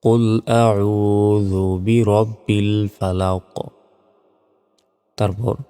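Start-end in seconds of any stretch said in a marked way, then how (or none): 0.0-3.5 very slow, drawn-out tajweed recitation; measured melodic model recitation; Quran recitation. Shown as a demonstration. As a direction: recite with long held vowels.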